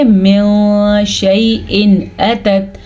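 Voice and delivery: "من" held as a nasal hum before "شیء"; slowly hide the n of "من"; ikhfa, hidden n. Shown as a demonstration. Teacher answering how to hide it, "من" hummed nasally. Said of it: "اسے ہم کیسے چھپائیں گے؟".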